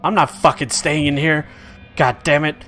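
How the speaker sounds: trapped man yells to be let out; angry demand. Acted. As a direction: rage at the locked door. Trapped jester demands door open.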